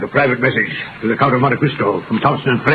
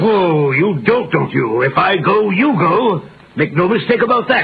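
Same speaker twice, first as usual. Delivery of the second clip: rising anger; 8 semitones higher than usual. Acted. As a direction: voice climbing higher as he threatens his partners. Partners quarrel over the failing bank.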